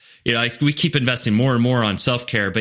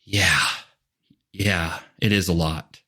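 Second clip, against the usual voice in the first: sighing relief; 6 semitones lower, slower than usual. Retold or imitated. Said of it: "this sigh of".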